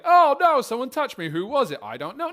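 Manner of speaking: high, loud, panicky squeal; silly high panic; mock flustered Jesus. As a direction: ham up a clueless flustered man. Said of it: "It's not like Jesus like".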